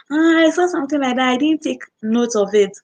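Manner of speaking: high, quick mock customer; sheepish customer. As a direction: brush it off like a careless caller. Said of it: "you go like".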